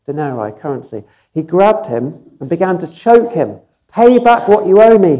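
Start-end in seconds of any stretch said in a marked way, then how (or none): 3.9-5.2 high, loud barked demand; angry demand. A narrator's reading. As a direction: snap it at him like a furious creditor. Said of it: "he demanded".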